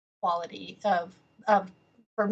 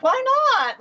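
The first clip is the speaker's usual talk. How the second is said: high, loud mock-defiant comeback; defiant retort; 7 dB louder than usual. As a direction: toss it back as a challenge, much higher and louder. Acting out defiant reply to corporate.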